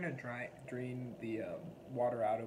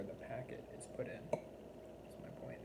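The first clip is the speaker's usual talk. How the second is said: whispering; 8 dB quieter than usual. Real, not acted. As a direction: breathe it out, unvoiced. Aside after cooking argument.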